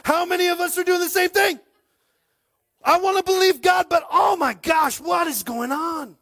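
loud, rising preacher's cry; preaching shout. Real, not acted. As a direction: lets it burst out in full voice.

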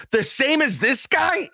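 high, drawn-out disbelieving question; incredulous protest. Retold or imitated. Defendant comparing himself to shotgun guy, incredulous. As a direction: squeak out an indignant question.

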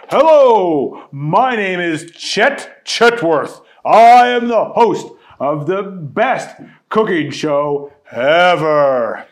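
loud, slow showman host; game show host. A narrator's reading. Chet greets the contestants, loud host voice. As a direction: boom it like a TV host.

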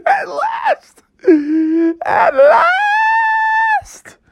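very high falsetto wail; hammed-up tearful shriek; crying for joy. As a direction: wail it out, stretching the last word. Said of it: "couldn't handle it anymore".

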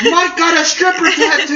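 high, slow, gleeful taunting call; mock shouting. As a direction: imitate buddies announcing it to everyone. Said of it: "everyone's shouting it out".

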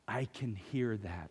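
very soft, slow and low, imitating speech through tears; retold tearful words. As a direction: hush it, as if barely getting the words out. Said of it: "through the tears".

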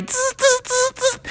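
short high bursts of buzz; bee buzzing. A narrator's reading. Bees swarming the players, buzzing. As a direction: punctuate speech with bee buzzes. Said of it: "the bees were like".

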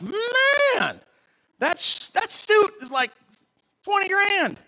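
high, loud, drawn-out marvelling; mock amazement. Viewer gawking at a TV preacher's suit, mock amazement.

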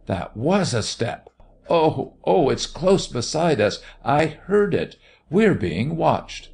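high, panicked outcry; terrified cry. A narrator's reading. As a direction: cry out in terror, pitch leaping up. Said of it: "she cried in terror".

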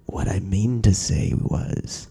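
slow, low mock rock-singer drawl; imitating a singer leaning into the mic, slowed right down; close-mic rock singer. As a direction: drag it out low and slow like a frontman teasing the crowd. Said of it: "gets really close to the mic and he goes".

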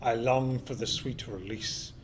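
weary whisper of a tired horse. Acted. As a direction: breathe it out like a sigh.

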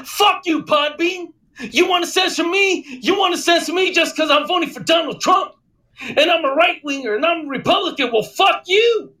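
high, heated mock rant; intense angry rant. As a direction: rant like an outraged podcaster. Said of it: "He goes, he goes like this".

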